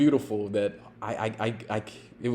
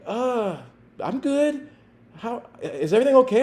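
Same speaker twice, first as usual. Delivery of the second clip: put-on meek kid; 12 semitones higher than usual. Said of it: "He's like".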